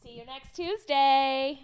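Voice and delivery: loud, drawn-out sign-off; sign-off call. Pretend. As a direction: call it out slowly to the listeners. Calling out the show's sign-off.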